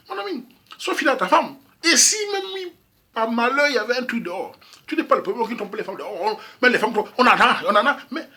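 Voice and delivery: excited. Real, not acted. Excited advice to a friend.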